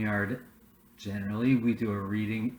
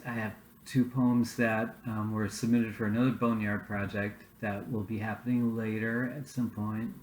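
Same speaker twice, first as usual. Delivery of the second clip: ordinary talk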